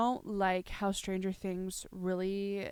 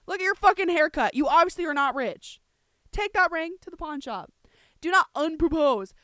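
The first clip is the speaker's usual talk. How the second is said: yelled opening, then heated high-pitched scolding; indignant, raised-voice ranting; aggressive rant; 5 semitones higher, 11 dB louder than usual. Real, not acted. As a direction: tell them off in loud exasperation. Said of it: "I did sound very aggressive".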